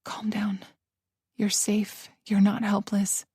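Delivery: soft, unvoiced self-soothing; whispered mantra. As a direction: whisper it over and over to yourself. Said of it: "repeated those words like a mantra".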